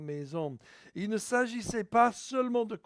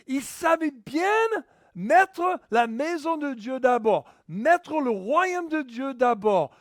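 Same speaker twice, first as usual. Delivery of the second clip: loud, raised-pitch sermon delivery; impassioned preaching; 5 semitones higher, 7 dB louder than usual. Real, not acted. Preacher urging the congregation to put God first, impassioned preaching. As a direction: drive each phrase home to the congregation, loudly.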